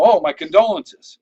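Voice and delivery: loud mock sympathy on condolences; deadpan joke. Joking to a new contact, loud mock condolence. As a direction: sell the punchline with fake pity. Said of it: "And I'll go".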